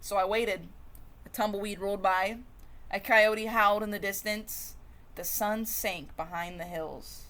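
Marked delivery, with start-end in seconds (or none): none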